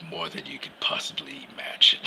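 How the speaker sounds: mysterious whisper; whispered Cyborg line. Retold or imitated. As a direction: breathe it out like a secret. Cyborg answers Wonder Woman mysteriously.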